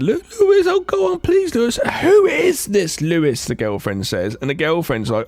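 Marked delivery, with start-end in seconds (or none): 0.0-3.4 very high falsetto begging; hammed-up high pleading falsetto; pleading woman voice. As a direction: whine his name in mock desperation. Curtis pleading, girlfriend questions.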